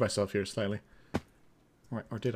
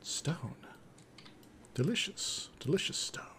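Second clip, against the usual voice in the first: whispering; much slower than usual. Real, not acted. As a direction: breathe the words as a whisper. Reading block names softly.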